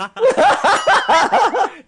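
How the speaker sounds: big burst of high laughter; real loud laugh, high and bright. Real, not acted. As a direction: crack up loudly.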